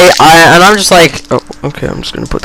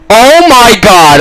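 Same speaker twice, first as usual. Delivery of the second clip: higher, drawn-out oh-my-god; alarmed exclamation; 6 semitones higher, slower than usual. Real, not acted. Storyteller caught watching the fight, high drawn-out exclamation. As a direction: stretch it out in dramatic dismay. Exclaims on being spotted eavesdropping.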